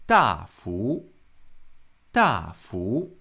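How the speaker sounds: 大幅 with 大 dropping hard and loud, 幅 rising; 大 falling forcefully, 幅 climbing up; fourth then second tone. Shown as a demonstration. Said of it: "We have a falling tone right here, and then a rising tone".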